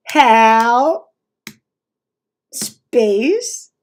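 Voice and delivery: loud drawn-out words, long gaps between; imitating slow typing. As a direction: mimic someone painfully slowly typing each word. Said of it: "he types, like, slow as shit".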